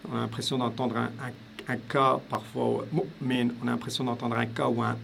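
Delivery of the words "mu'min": mu'min with a catch after mu that almost sounds like a K; mu'min split by a glottal stop; glottal stop demo. Shown as a demonstration. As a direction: cut mu short in the throat, then min, for mu'min. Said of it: "il y a un coup de glotte".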